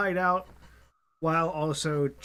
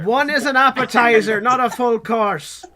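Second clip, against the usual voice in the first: loud boast; 5 semitones higher, 8 dB louder than usual. Acted. Boasting vampire hunter banter.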